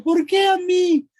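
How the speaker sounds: very high, loud mock wail; victim's lament. Speaker playing the victim questioning fate, exaggerated wail. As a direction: stretch it into a hammed-up, self-pitying cry. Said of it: "Entonces yo digo".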